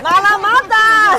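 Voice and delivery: loud, slow vendor cry; street hawking call. Pretend. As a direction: call it out to passersby. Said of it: "吆喝起来".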